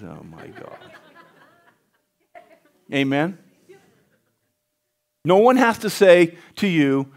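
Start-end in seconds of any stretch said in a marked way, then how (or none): 0.0-0.8 weary muttered sigh. Retold or imitated. Father shaking his head.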